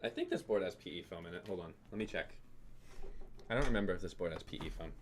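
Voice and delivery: quick, soft mumble under his breath; quiet, fast self-talk; muttering to himself. Real, not acted. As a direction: talk to yourself while searching. Checking boards, muttering to himself.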